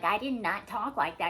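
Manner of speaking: loud, emphatic mock denial; defensive. Protesting an accusation in a mock row, defensive. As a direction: insist loudly, as if accused. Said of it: "You're defensive".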